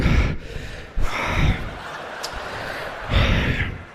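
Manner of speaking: out-of-breath gasps, long and slow; exhausted pilot catching his breath; heavy winded panting. Acted. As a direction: pant hard into the mic. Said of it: "He's totally out of breath".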